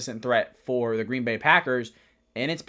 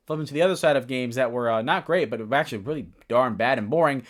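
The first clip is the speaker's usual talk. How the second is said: usual speaking voice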